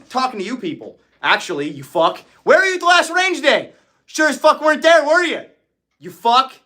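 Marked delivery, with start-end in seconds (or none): 2.4-6.5 high, loud drill-instructor tirade; mock yelling. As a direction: tear into the slacker who skipped range day. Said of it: "They all think you're yelling at me".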